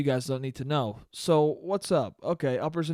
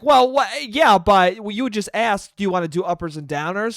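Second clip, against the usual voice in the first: mock mom; 5 semitones higher, 7 dB louder than usual. Mom voiced in retold argument.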